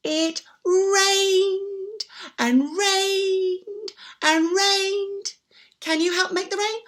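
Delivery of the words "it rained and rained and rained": very slow, stretched rained. Acted. Storyteller to children, very slow stretched telling. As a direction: draw every rained out.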